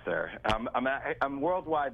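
usual speaking voice

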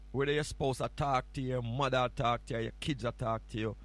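ordinary talk, nothing marked

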